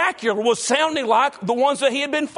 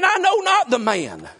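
higher, slow mock oath; Peter swearing; 6 semitones higher than usual. A narrator's reading. Preacher voicing Peter denying Jesus with a mild oath. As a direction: spit out a half-acted curse, slowly. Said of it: "began to curse and to swear".

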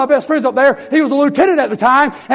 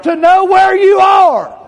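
shouted; 8 semitones higher, 4 dB louder, much slower than usual. Real, not acted. Preacher shouts the point.

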